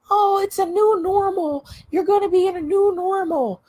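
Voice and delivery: high, sing-song mock voice; mocking a platitude. Mocking what people told her, in a high sing-song voice. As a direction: parrot the cliché in a high mocking tone. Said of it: "I heard this a million times".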